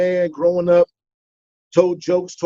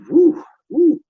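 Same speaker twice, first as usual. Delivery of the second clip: excited woo; 9 semitones higher, 4 dB louder than usual. Retold or imitated. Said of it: "I was like".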